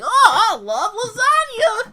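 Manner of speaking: put-on loud, drawn-out, very high boy voice; Gohan. Game master mocking himself as Gohan, loud high squeal. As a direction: squeal it slowly, mocking your own kid voice. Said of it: "pretending to be Son Gohan".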